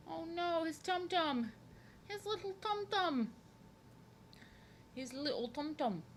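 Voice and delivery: put-on high, cooing baby-talk voice; cutesy baby talk. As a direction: coo playfully over something tiny and cute. Cooing over the drawn character's tummy.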